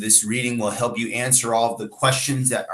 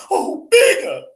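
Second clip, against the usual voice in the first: high whimpering cry; 6 dB louder, slower than usual. Acted. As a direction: whimper his name. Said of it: "She whimpered".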